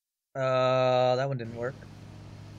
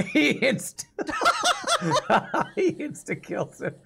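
breaking up with laughter; high cracking laugh mid-sentence; 11 semitones higher than usual. Real, not acted. Laughing at clip kill.

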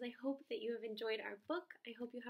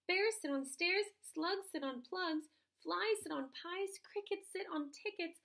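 high, quick listing cat; 6 semitones higher, 6 dB louder than usual. Acted. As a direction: rattle off the list as the cat. Cat lists all the rhymes.

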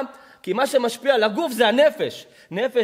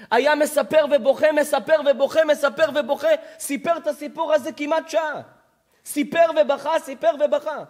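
raised, emphatic repetition; impassioned preacher, higher than usual; fervent preaching; 6 semitones higher, 5 dB louder than usual. Real, not acted. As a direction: pile up each phrase with rising fervour. Preaches with rising fervour.

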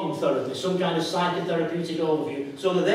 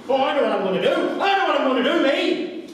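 higher, lightly put-on voice of a drinker planning; mock alcoholic inner voice; 7 semitones higher than usual. Alcoholic talking himself into drinking, hammed-up voice. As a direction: play the bargaining drinker in your head.

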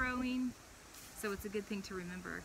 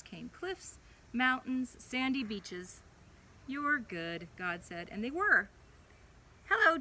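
put-on gentle storybook read-aloud for a child; animated children's Bible story voice; 4 dB louder than usual. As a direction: tell it like a bedtime story.

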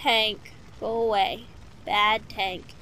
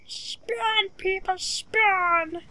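slow stretched begging; drawn-out plea; 5 semitones higher, much slower than usual. Pretend. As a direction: drag out every word, pleading. Begging players to spawn.

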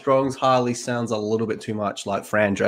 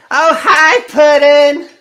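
very high, loud, squealing character voice; piercingly high mock-sweet greeting; Harley Quinn; 10 dB louder, much slower than usual. Shown as a demonstration. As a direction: shriek it like a hammed-up cartoon girlfriend. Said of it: "gimme a Harley Quinn line".

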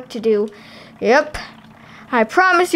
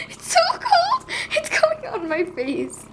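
high squeal; 4 dB quieter than usual. Acted. Doll shrieks at cold soap.

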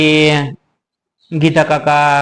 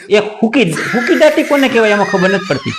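much higher, exasperated rant; 8 semitones higher than usual. Real, not acted. Ranting at the friend who doesn't know હુકી દાટી, exasperated. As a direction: throw up your hands at him.

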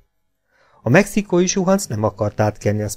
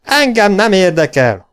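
loud, high, slow bellow; yelled refusal; 10 semitones higher, 7 dB louder than usual. A narrator's reading. Robber refusing to ride ahead, yelled. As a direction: bellow it out, drawing the words out. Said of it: "ordított Gunner".

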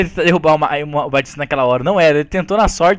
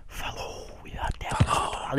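breathy whisper goodbye; whispered sign-off; 15 dB quieter than usual. Acted. Youtuber whispering goodbye to his viewers. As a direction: whisper the farewell playfully.

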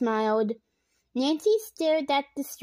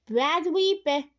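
usual speaking voice

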